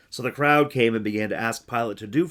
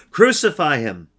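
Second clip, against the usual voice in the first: crowd's shout; 5 semitones higher, 11 dB louder than usual. Acted. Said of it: "they shouted all the more".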